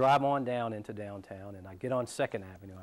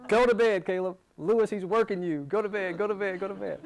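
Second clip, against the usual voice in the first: wife calling out; 8 semitones higher, 5 dB louder than usual. Retold or imitated. Wife calling him to bed.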